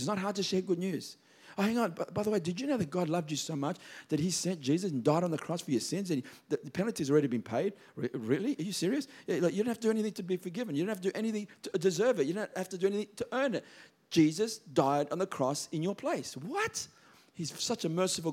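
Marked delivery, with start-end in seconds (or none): 1.6-13.6 casual chat. Pretend. Acting out casual gospel sharing. 16.3-16.9 mock amazement.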